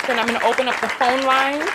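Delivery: ordinary talk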